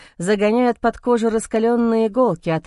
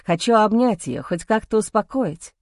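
plain reading voice

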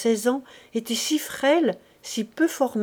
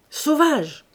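higher, louder cry of Sauvage; shouted insult; 5 semitones higher, 4 dB louder than usual. A narrator's reading. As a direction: fling the word after the fleeing boy. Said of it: "cria Chantot".

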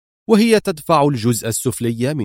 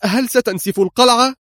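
high, wide-eyed question; alarmed exclamation; 10 semitones higher than usual. A narrator's reading. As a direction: cry out in astonishment. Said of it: "وهي تهتف". (هتاف مذهول بصوت مرتفع)

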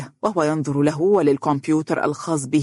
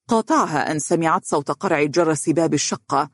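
plain reading voice

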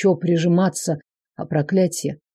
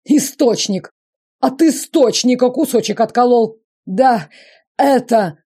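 higher, louder breathless lament; gasping for breath; 6 semitones higher, 4 dB louder than usual. Acted. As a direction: wail it out while gasping. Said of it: "причитал задыхавшийся император".

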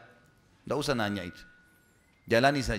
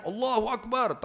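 retold takbir cry; 7 semitones higher, slower than usual. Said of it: "takbir semua".

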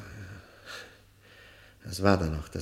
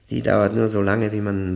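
louder, hard-edged bitterness; bitter outburst; 8 dB louder than usual. Acted. Prisoner lashing out at the prosecutor, bitter and loud. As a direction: throw the line out in bitter anger. Prisoner bursts out about hope.

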